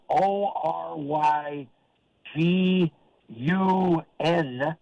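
very slow, letter by letter; spelled out. Shown as a demonstration. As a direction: spell it deliberately, gaps between letters. Spells out shirt slogan.

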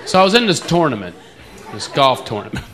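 ordinary talk

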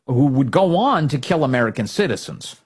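normal talking voice

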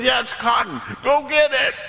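high, urgent mock command; acted-out frantic excitement; excited captain's order. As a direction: send the crew running. Excited exchange, captain orders crew.

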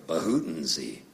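low, soft coughed-out nonsense word; imitated cough-word. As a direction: mimic dad turning a cough into a word. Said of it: "my dad used to cough".

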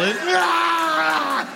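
high, wild scream; feral shriek. Acted. Wife chained to the radiator lets out a shriek. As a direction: shriek like a charging beast. Olga charging, shout.

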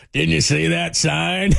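slow mock yell from the manager; Bruce Bochy. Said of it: "starts yelling at you".

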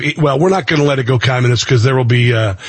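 ordinary talk

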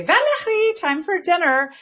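a hint of a French-Canadian mom calling her kid in to eat; mother's French accent. Mother calls daughter in for dinner, light French accent. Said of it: "that pronunciation for my name".

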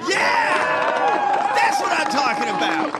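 loud, high-pitched whoop of approval; roaring cheer. Real, not acted. Host cheering the candidate's comeback, roaring cheer. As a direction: yell it like a fan.

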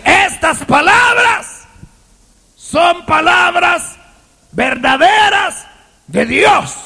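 slow, high, shouted declaration; emphatic preaching. Real, not acted. Preacher declaring to the church that the words are true, slow shouts. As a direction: hammer each word home.

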